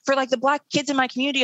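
high-pitched, mock-eager helper voice; condescending do-gooder. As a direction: imitate a self-pleased volunteer, pitched well up. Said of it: "That sounds really condescending".

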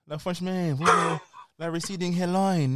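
mock French accent; hammed-up French-accented jibe; put-on French accent. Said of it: "put that accent on".

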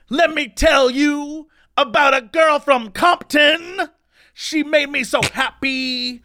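slow, high drawn-out holler; imitated shouting. Homeless man shouting at everyone in line, slow mock holler. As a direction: holler it slowly like a rowdy drunk. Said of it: "they were shouting at everyone in line".

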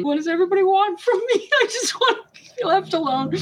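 high, rushed plaintive whine; comic high-pitched wail; mock delirious plea. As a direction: imitate an exhausted woman in labor, high and laughing. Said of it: "you were so exhausted and delirious almost".

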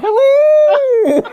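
high loud laughing falsetto; Mrs Doubtfire voice. Pretend. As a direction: squeal it like a nanny. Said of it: "Missus Doubtfire".

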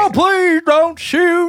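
high, slow whiny Southern drawl; mock Southern accent. Co-host imitating the villain's fake Southern plea, Southern accent. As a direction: plead in a hammed-up Southern accent. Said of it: "When he goes Southern".

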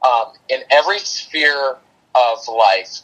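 ordinary talk, nothing marked

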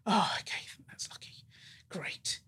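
hushed retold whisper of relief; a whispered aside to herself. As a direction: drop to a breathy whisper, almost to yourself. Retold own stunned reaction.

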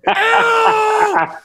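loud, high, drawn-out shout; hammed-up high holler; imitated yell. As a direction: bellow it in mock fury. Re-enacts the yelled "out.

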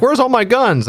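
high squeaky Harley whine; mock whine. Harley whining about her missing guns, high and squeaky. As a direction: squeak it out like a lost-toy complaint.

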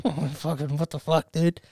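soft, choked-up mock muttering; holding back tears. Viewer watching dying dog's farewell, holding back tears. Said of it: "just holding back my tears".

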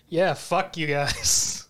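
slow, drawn-out taunt; imitated smug gloating. Yuichi taunting the other players while being hit, mock gloating. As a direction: stretch it out through a mad grin. Voicing Yuichi with mad grin.

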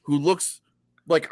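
normal talking voice

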